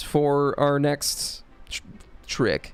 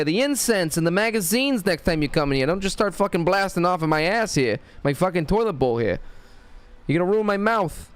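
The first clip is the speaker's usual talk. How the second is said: put-on higher, scolding Boston accent; hammed-up Boston tough-guy rant; 5 semitones higher than usual. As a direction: chew someone out as an indignant Boston guy. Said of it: "more like a Boston kinda guy".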